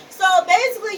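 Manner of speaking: usual speaking voice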